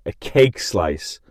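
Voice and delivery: slow, deliberate "A cake slice"; unhurried reveal; slow announcement. Shown as a demonstration. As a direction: draw out the words. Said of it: "Clap my Macau".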